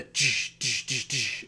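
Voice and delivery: hissed "tsh" bursts for lights switching on; imitated light clunks. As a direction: mimic lamps firing up one by one.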